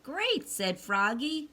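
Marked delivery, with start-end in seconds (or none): 0.0-0.5 much higher, bright great; delighted. A narrator's reading. Froggy cheering about going out to celebrate, high. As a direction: burst with excitement.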